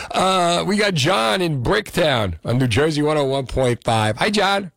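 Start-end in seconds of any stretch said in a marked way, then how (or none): none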